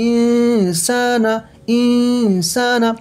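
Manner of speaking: ইনসানা with the ন hummed long through the nose; slow chanted ইনসানা, holding the nasal hum; ghunnah. Shown as a demonstration. Quran teacher demonstrating the ikhfa rule, ghunnah. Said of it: "গুন্না হচ্ছে".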